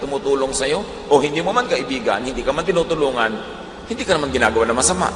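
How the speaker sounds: usual speaking voice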